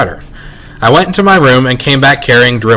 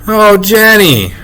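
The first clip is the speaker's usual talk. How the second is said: high, drawn-out motherly dismay; appalled mom's exclamation; dismayed cry; 8 semitones higher, much slower than usual. A narrator's reading. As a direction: cry out in disbelief, stretching it. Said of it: "my mother cried".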